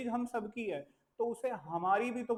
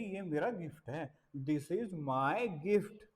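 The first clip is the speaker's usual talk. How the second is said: slow teacher drill; 4 semitones lower, slower than usual. Shown as a demonstration. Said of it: "अब ये लड़की कहेगी".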